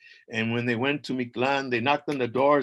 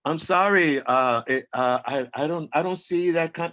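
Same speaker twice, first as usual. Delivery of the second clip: lightly voiced raised assistant reply; a hint of a phone assistant apologizing; mild mock Siri, a little higher and louder; 4 semitones higher, 4 dB louder than usual. Siri replies.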